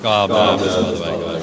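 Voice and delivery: exasperated loud protest; loud groan. Real, not acted. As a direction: loudly protest being called up. Reacts to being called up.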